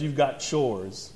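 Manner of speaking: low, soft, slow dad delivering bad news; dreaded dad voice. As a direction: drag out the dreaded chores line.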